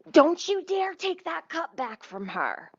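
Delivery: high, drawn-out mock scolding; indignant scolding. Woman behind the counter warns the worker, mock scolding. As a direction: imitate an outraged warning, slow and high.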